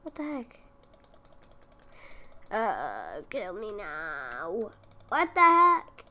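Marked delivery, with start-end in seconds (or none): 5.1-6.0 loud, high exasperated cry; frustrated outburst. Real, not acted. Gamer yelling at the glitching game, loud and exasperated. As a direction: blurt it out in frustration.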